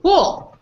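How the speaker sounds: "Pool" said high, with a long oo, falling; wrong-part POOL. Shown as a demonstration. Teacher points out the word in the wrong one, emphatic POOL. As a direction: pick out Pool as its own word, pitched high. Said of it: "there is no pool".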